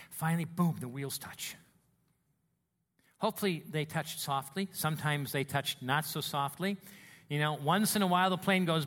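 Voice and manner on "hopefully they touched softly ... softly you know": usual speaking voice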